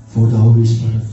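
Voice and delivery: usual speaking voice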